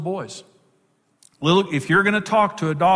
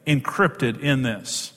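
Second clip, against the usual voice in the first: ordinary talk, nothing marked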